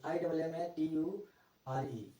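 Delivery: slow letter-by-letter spelling of आई डबल एम ए टी यू आर ई. Shown as a demonstration. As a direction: spell each letter of आई डबल एम ए टी यू आर ई apart, slowly. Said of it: "spelling पे ध्यान देंगे".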